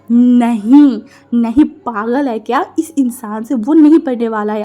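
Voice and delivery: loud, alarmed no; panicked refusal. Acted. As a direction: burst out in panic. Wing's panicked inner refusal. (घबराकर ज़ोर से मना करना)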